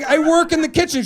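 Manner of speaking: high, meek servant plea; kitchen lady. Retold or imitated. Kitchen lady pleading with the witch, high and meek. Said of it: "She's like".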